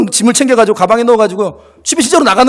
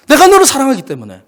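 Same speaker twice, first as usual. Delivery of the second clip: higher and louder, 너를 stressed; emphatic repeat; 6 semitones higher, 4 dB louder than usual. Shown as a demonstration. Preacher repeating God's love to the church, louder. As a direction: proclaim it again with force. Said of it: "그 마음의 중심은 뭐다?".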